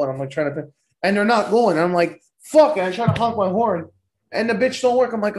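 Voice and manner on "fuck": frustrated curse. Retold or imitated. Horn broken, stuck at light.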